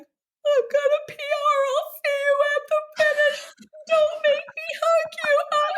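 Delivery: high, tearful, half-sung pleading; retold sobbing at her mom mid-race. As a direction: cry the words out high while running. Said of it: "and I'm sobbing".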